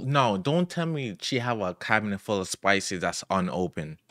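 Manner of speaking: ordinary talk, nothing marked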